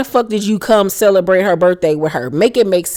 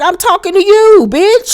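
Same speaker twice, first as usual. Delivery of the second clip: high, loud imitating Tokyo's yell; mock yell; 12 semitones higher, 4 dB louder than usual. Vlogger imitating Tokyo yelling at Carly, high mock yell. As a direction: shriek it like Tokyo telling Carly off. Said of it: "And Tokyo was like".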